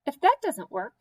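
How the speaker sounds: ordinary delivery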